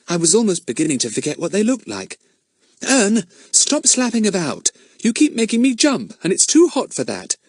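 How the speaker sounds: put-on girl's voice, mildly cross. Daisy voiced in the story.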